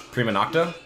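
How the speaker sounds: usual speaking voice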